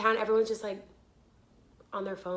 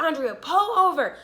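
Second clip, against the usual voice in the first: higher, louder motherly command; imitated urgent order; 5 semitones higher, 5 dB louder than usual. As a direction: call out as a worried mother.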